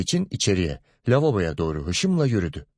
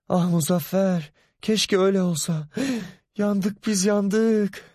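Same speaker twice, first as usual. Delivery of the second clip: very high, despairing wail; wife lamenting to her husband; wailing mother; 11 semitones higher than usual. Acted. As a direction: cry out that all is lost.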